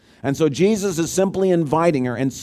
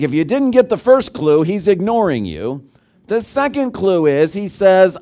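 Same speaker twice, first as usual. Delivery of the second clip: ordinary talk, nothing marked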